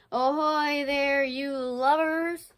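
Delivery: hailing the crew, stretched out; pirate hail. Acted. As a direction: call out slowly like a stage pirate.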